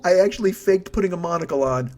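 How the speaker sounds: ordinary talk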